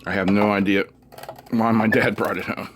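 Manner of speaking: ordinary talk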